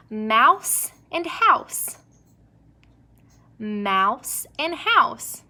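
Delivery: slow, even teacher read; slow rhyme demo. Shown as a demonstration. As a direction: stretch both words for the class. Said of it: "They do rhyme".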